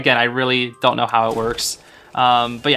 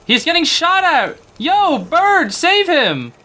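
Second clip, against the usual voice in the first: excited high call; 14 semitones higher, much slower than usual. Real, not acted. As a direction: yell for the bird to help. Excited calling to the bird.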